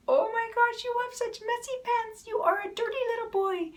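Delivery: put-on high, sing-song baby talk over a scolding line; sweet baby talk. Shown as a demonstration. Mother coos at her baby about messy pants in sweet baby talk. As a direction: coo it to a baby like it's praise. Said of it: "you can say to a baby".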